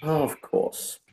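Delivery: dry reaction. Acted. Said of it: "That's just his reaction".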